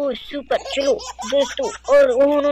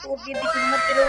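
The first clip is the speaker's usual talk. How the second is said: put-on very high, cooing voice for the toy baby; soothing the baby. Kid playing, hushes the crying toy baby in a high coo.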